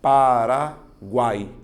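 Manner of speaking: "Paraguai" very slow and loud, pa-ra-guai; syllable by syllable. Shown as a demonstration. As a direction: break "Paraguai" into three drawn-out syllables, loudly. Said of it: "vou fazer a separação".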